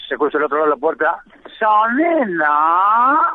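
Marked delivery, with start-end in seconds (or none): 1.6-3.2 higher, very slow, stretched speech-impaired voice; voz gangosa muy lenta y aguda; gangoso voice. Acted. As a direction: drag out the punchline through the door. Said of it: "lo que haces de gangosos".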